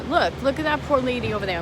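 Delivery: ordinary talk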